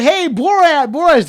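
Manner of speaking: loud high call across a crowd; excited shout of recognition. Retold or imitated. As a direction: yell his name to get his attention. People calling out to Borat.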